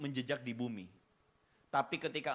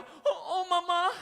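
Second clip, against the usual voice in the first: much higher, louder, laughing; hammed-up teary daughter; mock sobbing daughter; moved daughter; 13 semitones higher, 8 dB louder, slower than usual. Daughter answers mother, moved.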